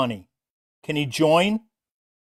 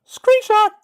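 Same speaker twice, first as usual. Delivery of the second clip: much higher, playful squeak on Screenshot; squeaky screenshot call; 14 semitones higher, 4 dB louder than usual. Pretend. Streamer calling a screenshot for viewers, high playful squeak. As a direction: chirp Screenshot like a camera toy.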